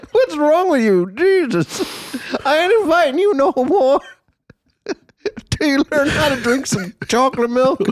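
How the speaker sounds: high, laughing, put-upon host scolding a guest; disgruntled host voice. Acted. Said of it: "That's my disgruntled fucking host voice".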